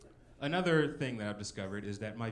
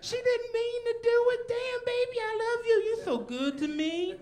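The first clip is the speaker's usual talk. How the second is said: wailing, very high and loud; a hammed-up sobbing beaten boyfriend; mock crying plea; 7 dB louder, slower than usual. Crying boyfriend begging police to spare her, wailing high. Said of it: "I'm just sitting over there crying".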